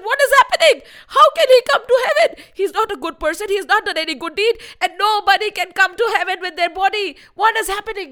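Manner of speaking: high, indignant protest; alarmed outrage. A narrator's reading. Gods object to Trishanku coming to heaven, alarmed outrage. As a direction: sputter in high-pitched disbelief.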